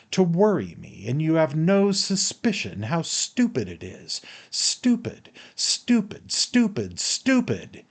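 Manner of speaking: exasperated, high-pitched repeated jabs; worked-up scorn, voice jumping high; angry tirade. A narrator's reading. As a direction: spit out each repeated word in high exasperation. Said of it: "I shouted, turning crimson with anger".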